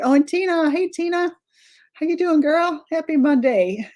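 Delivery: light up when you see Tina; excited greeting. Real, not acted. Spots a friend in chat.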